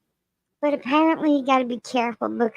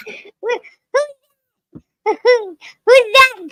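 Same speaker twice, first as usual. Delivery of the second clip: cute creature voice; 8 semitones higher, 4 dB louder, slower than usual. Pretend. Voicing a puzzled animal.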